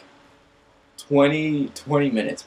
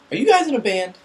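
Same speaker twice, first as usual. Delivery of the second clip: put-on eager goth girl; 12 semitones higher, 5 dB louder than usual.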